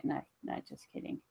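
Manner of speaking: whispered, no voice at all; whispered take-back. Acted. Giant reassuring screaming kids, whispered. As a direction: breathe it as a sheepish retraction.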